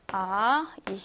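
normal talking voice

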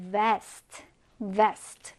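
"vest" with a careful initial V; clear V sound. Shown as a demonstration. Teacher showing learners how to pronounce vest, clear V sound. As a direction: model "vest", starting on a clear English V. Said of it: "V 발음을 잘 해주셔야 돼요".

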